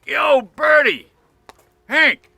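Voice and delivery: loud, high, drawn-out holler; father's shouted greeting, imitated; calling out.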